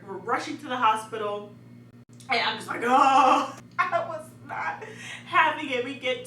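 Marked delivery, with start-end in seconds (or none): 2.8-3.6 loud pained "oh"; imitated groan. Labouring woman in the car, imitated loud groan. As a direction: let out a loud, drawn-out groan.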